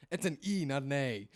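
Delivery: high and loud, punching E and A; emphatic correction. Pretend. Correcting the co-host's ketchup, emphatic and loud. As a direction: stress E, then A in contrast. Mock-indignant correction, raised voice.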